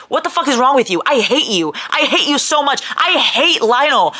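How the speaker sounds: angry rant. Real, not acted. Ranting at hateful character.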